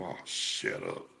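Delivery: low, slow grumble under the breath; said to himself. Retold or imitated. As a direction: softly imitate a private retort. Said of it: "said to myself".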